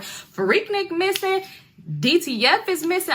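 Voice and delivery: high, slow, dramatic "missing"; dramatic announcement. Retold or imitated. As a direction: stretch it out like breaking news. Messy announcing the missing pair.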